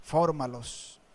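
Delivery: lightly voiced Lord, low and soft. Lord answering the crying pastor, low soft command. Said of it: "el Señor me dijo".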